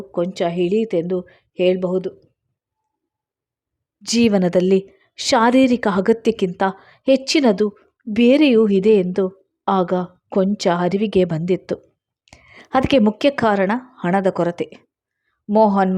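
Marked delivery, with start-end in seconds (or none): none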